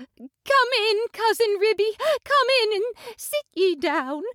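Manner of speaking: high, plaintive mother cat welcoming her cousin; a fretful cat greeting a visitor; Tabitha the mother cat. A narrator's reading. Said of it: "mewing dreadfully".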